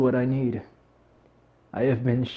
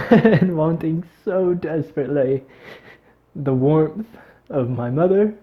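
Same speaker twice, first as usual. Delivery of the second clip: higher voice, laughing through tears; close to crying; 4 semitones higher, 4 dB louder than usual. Real, not acted. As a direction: voice wavering as tears come. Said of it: "wanting maybe even to cry, like I am right now".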